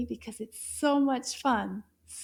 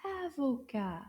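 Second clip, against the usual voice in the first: usual speaking voice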